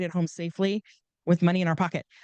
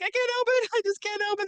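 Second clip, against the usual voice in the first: high, pleading, choked-up can't-help-it; mock teary high voice; tearful high whine; 14 semitones higher than usual. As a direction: whine it out like you're welling up in church. Crying at church, friend asks why.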